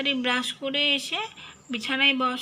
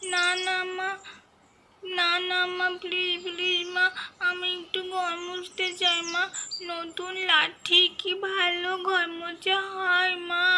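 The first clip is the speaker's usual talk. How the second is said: put-on higher little child's voice, wheedling; pleading child; 5 semitones higher than usual. Child begging her mother to let her mop the floor. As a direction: coax your mum, please please.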